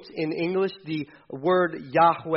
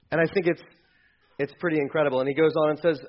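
normal talking voice

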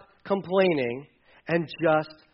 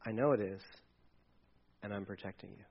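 mama bird soothing; 8 semitones lower, 14 dB quieter than usual. Acted. Mother bird comforting chick.

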